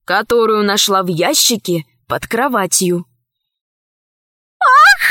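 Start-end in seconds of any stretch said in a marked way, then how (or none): 4.6-5.0 loud, very high gasp of joy; piercing, overjoyed "ah"; delighted exclamation. A narrator's reading. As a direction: cry out in sudden delight. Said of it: "воскликнула наследница".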